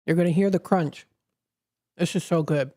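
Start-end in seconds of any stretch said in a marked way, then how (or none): 2.0-2.7 talking through a mouthful; chewing while speaking; mouth full. Real, not acted. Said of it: "I'm sorry, I'm eating on the air".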